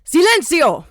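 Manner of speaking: loud barked order to silence the room; commanding shout. Acted. Madam silencing her armed guests, shouting. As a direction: cut everyone off at full volume.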